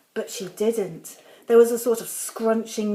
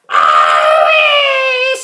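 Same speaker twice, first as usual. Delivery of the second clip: put-on high, loud old woman's shriek; screamed owie; 12 dB louder than usual. A narrator's reading. Grandma screams as the medicine kicks in. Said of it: "she screamed".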